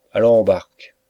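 loud, commanding call; firm call. A narrator's reading. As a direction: call him to order across the room. Inspector calls out to Bark.

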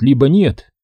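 ordinary delivery, nothing marked

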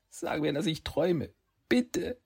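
put-on high, pleading young voice; boy pleading. Boy Saki begs Kitsune, high and pleading. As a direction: beg her to say it's a dream.

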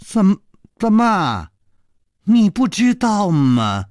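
genuinely astonished asking; surprised. Acted. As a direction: sound caught off guard. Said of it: "惊讶地说".

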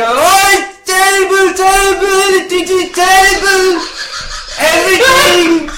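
high, loud, drawn-out mock wail; Mister Chumbunga crying. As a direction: wail slowly in hammed-up grief. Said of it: "Do Mister Chumbunga crying".

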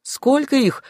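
high call from afar; shouted question. A narrator's reading. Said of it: "крикнул кто-то". (выкрикнутый вопрос)

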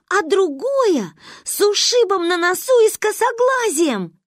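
building from eager to high-pitched excitement; excited explaining. A narrator's reading. Girl explaining her plan to avoid punishment, excited.

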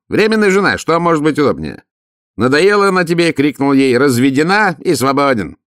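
высоко и громко, напористо; граф громко втолковывает рассказчику, высоко и громко; громкий граф. A narrator's reading. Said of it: "отвечал он". (граф кричит, высоко и громко)

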